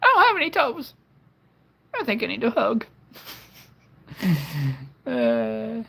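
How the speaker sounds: high, breaking crying voice. Pretend. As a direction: sob it out like a hurt child. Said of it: "It's my crying voice".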